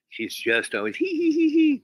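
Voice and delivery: high, loud mock giggle; teasing giggle. Storyteller imitating the forest girl's giggle. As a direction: giggle like a girl luring him on. Girl giggles leading him on.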